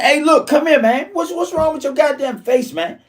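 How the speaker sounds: high, urgent call to come closer; urgent call to a friend. Retold or imitated. Calling his bruised friend over to look, high and urgent. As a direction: pitch up and wave the friend over.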